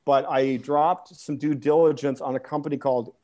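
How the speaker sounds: usual speaking voice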